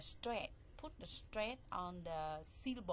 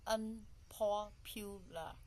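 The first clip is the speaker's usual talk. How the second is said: "unpopular" broken slowly into four syllables; much slower than usual. Shown as a demonstration. Teacher counting out the four syllables of "unpopular", syllable by syllable. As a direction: say "unpopular" slowly, a gap after each syllable. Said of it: "So we put in four syllable".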